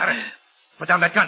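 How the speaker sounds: loud, high alarmed cry; panicked order; alarmed shout. Acted. Kalanza facing gun.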